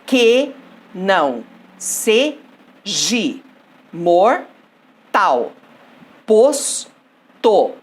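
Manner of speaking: "Que não seja mortal. Posto" chopped into isolated syllables with pauses. Shown as a demonstration. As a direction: count out "Que não seja mortal. Posto" one loud, separate beat at a time. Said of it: "Então vamos dividir". (sílaba por sílaba, "Que não seja mortal. Posto" bem separado)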